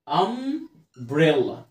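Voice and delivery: very slow, drawn-out "امبريلا" split after "ام"; slow sounding out. Shown as a demonstration. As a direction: stretch "امبريلا" in two long halves. Teacher sounds out the new word. (نطق "امبريلا" ببطء شديد وبمدّ)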